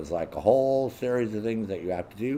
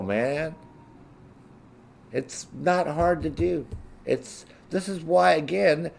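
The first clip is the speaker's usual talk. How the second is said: ordinary talk, nothing marked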